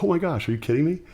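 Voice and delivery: high-pitched, amazed exclamation; delighted disbelief. Retold or imitated. Musician spotting a long-lost friend at a gig, amazed. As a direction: exclaim it, pitch jumping up in surprise.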